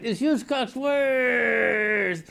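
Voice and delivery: slow, stretched, crying-edged sign-off; host signing off with a stretched name; announcer sign-off. Acted. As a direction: draw the name out very slowly.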